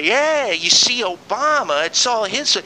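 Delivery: high, slow sneering mimic of gloating critics; mocking gloat. Host mocking Obama critics with a smug, sneering voice. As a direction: lift it up and drag it out like a smug know-it-all. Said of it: "'Cause they".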